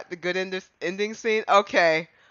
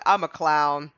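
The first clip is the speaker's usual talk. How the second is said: usual speaking voice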